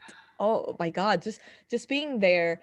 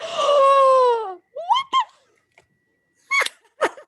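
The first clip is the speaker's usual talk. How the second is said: high, loud, half-laughing squeal; starstruck shriek; 8 dB louder, slower than usual. Retold or imitated. Retelling seeing Kobashi backstage, a high excited shriek. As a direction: squeal like a fan spotting a legend. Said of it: "I was so freaking excited".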